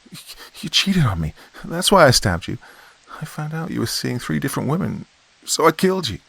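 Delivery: lashing out. A narrator's reading. Wife lashing out at her cheating husband's ghost, furious. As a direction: hurl the accusation at him. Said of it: "Lindsay then lashed out at the ghost".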